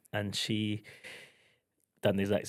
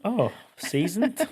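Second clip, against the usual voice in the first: teasing surprise; 11 semitones higher, 4 dB louder, slower than usual. Real, not acted. Teasing cohost's word.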